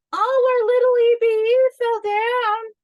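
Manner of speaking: high, loud, sustained mock cry; put-on high-pitched dismay; mock exclamation. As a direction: draw it out high and loud in one held pitch. Mock cry for a fallen alien.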